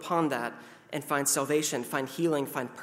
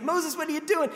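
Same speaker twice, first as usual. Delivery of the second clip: panicked crowd calling Moses; 12 semitones higher than usual. Retold or imitated. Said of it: "They're like".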